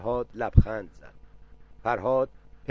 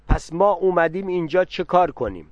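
loud, higher angry retort; angrily; 6 semitones higher, 7 dB louder than usual. A narrator's reading. Aunt waving her hand, angrily demanding of the father. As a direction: snap back, louder and higher. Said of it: "با عصبانیت گفت".

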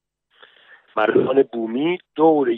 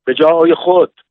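loud command, pitched up; shouted order; 5 semitones higher, 7 dB louder than usual. A narrator's reading. Sergeant to soldiers as villagers come out, shouted order. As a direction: bark out the order to the ranks. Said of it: "گروهبان داد زد".

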